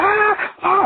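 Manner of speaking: high, retold kid's gaming yell; imitated yelling. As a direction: mimic the kid screaming at the game. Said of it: "he been there yelling and screaming like".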